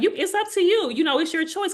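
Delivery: high, easy voice giving her the choice; imitating her doctor. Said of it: "she was like".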